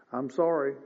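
ordinary talk, nothing marked

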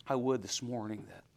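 normal talking voice

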